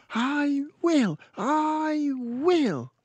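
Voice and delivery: put-on girl telling librarian she loved the book; delighted little girl. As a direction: gush it like an enchanted child. Girl eager promise.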